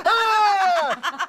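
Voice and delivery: high, loud mock yelp with a laugh; pained laughing yelp. Retelling the burning fog, loud laughing yelp. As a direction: yelp as if scalded.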